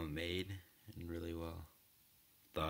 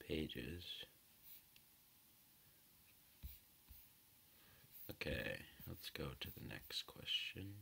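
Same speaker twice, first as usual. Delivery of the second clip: whispering; 8 dB quieter than usual. Real, not acted. Muttering to herself between tasks.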